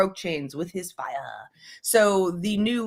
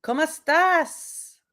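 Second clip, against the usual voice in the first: playful Spanish; 9 semitones higher, slower than usual. Pretend. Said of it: "I just like to break out in different languages".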